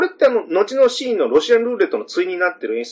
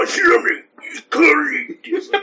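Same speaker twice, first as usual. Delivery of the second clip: 甲高くかすれた怪人の声; 超人になりきって声色で叫ぶ; アシュラマン; 12 semitones higher than usual. Acted. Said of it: "アシュラマンは。". (甲高い怪人の物真似)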